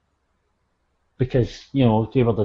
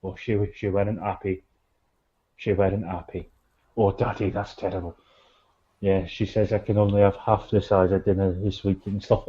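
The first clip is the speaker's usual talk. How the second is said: quiet mock glum; 4 semitones lower, 5 dB quieter than usual. Daddy answering, subdued.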